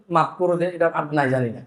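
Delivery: put-on worn-out parent brushing off a child; exasperated giving up. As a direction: let it trail off like an exhausted parent. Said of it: "শেষ পর্যন্ত আপনি বাধ্য হবেন".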